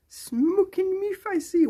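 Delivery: slow squeaky silly voice; high silly voice. Pretend. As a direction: read it out like a cartoon. Reading odd phrase in a voice.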